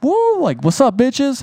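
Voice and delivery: high whooping cheer, showing off mid-jump; a big high woo like a cocky rider; retold whoop. Retold whoop while jumping bike.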